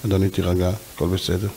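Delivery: low, soft, soothing reassurance; calm soothing. Retold or imitated. Calming a shouting man, soft and low. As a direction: talk him down gently and quietly.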